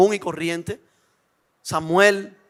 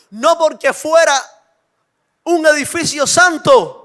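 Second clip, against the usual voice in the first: loud, high sermon delivery; fiery preaching; 6 semitones higher, 5 dB louder than usual. Acted. Preacher challenging the congregation, loud and fervent. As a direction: press the point home to the listeners.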